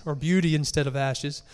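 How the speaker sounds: ordinary talk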